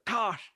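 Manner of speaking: sudden high-pitched "tash" sound effect; imitated crash noise. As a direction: mimic the sharp crack at the window. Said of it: "ki awaaz".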